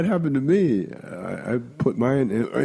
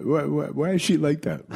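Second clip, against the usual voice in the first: put-on puzzled old lady; 7 semitones higher than usual.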